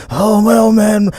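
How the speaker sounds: slow, drawn-out drawl mimicking a singer. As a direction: stretch every word in a mock star voice. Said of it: "that [name] voice that he's adapted".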